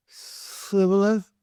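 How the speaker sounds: high, slow sibboleth with an s; careful s in Shibboleth; slow mispronounced shibboleth. Retold or imitated. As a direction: drag out Shibboleth with s not sh. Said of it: "it would come out s".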